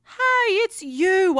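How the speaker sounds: light up as you recognize him; excited recognition. Acted. Recognizes the famous detective.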